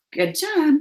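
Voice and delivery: ordinary talk, nothing marked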